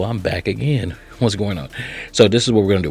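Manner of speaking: ordinary delivery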